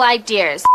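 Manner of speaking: "ideas" with an R before the z, as eye-DEERS; intrusive R. Real, not acted. Reader delivering the promo line, "ideas" slipping into eye-DEERS. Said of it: "you're saying ideas?".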